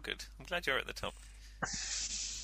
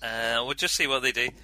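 ordinary delivery

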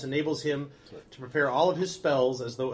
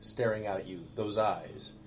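eerie narration; 6 semitones lower, 8 dB quieter than usual. A narrator's reading. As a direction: draw them into a creepy reveal. GM eerie description.

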